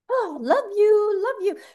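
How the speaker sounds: high, cooing love you; gushing high affection. Retold or imitated. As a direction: coo it lovingly with a laugh. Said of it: "I'm like".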